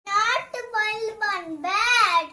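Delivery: very loud, high, drawn-out cry; loud calling out. Real, not acted. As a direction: belt it out slowly, stretching every word. Child calls out playful phrase. (ഉറക്കെ, നീട്ടി വിളിച്ചുകൂവൽ)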